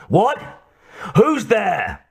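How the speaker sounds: high-pitched startled call; startled demand. A narrator's reading. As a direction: call out high, wanting an answer. Man calls to unseen captive.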